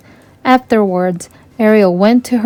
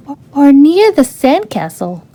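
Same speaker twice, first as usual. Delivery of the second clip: shouted; 5 semitones higher than usual. A narrator's reading. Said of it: "Aquata shouted".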